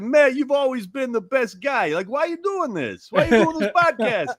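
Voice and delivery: high, punchy Philly mobster voice; Philly Sopranos accent. Retold or imitated. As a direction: imitate the Sopranos-type co-host. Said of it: "straight out of central casting for The Sopranos".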